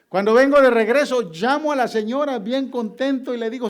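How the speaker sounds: loud, high, jubilant preaching; preaching at full force. Acted. A preacher joyfully telling the congregation he has the money, loud and high. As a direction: shout the good news to the congregation.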